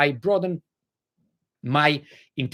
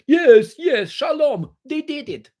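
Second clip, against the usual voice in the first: much higher, eager sycophant voice; mocking yes-men; 12 semitones higher, 6 dB louder, slower than usual. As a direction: parrot the claim like a fawning crowd. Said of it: "And everybody here".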